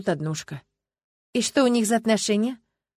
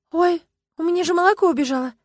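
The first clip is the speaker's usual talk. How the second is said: gasp, then quick high-pitched alarm; startled realization; 9 semitones higher, 3 dB louder, faster than usual. A narrator's reading. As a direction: blurt it out as if you just remembered. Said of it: "спохватилась она". (спохватившись, испуганно)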